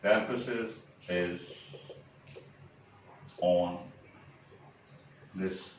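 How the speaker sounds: very long pauses between words; word-by-word dictation. Shown as a demonstration. Spelling out an example while writing.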